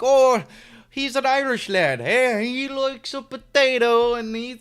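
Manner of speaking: put-on high-pitched, loud, over-the-top Irish accent; cartoonish Irish accent, pushed high and loud; Irish impression. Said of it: "That was my best Irish impression".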